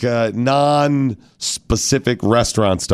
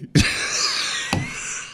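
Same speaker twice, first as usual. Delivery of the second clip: high breathy laugh; 6 dB quieter than usual. Real, not acted. Laughing at own story.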